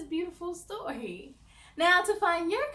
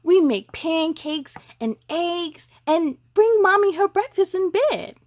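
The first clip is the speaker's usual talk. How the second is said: lively picture-book read, louder; reading to children; 3 semitones higher, 12 dB louder than usual. Acted. Host reads a boy's story to young viewers, louder storybook voice. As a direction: read it out to the little ones, bright and full.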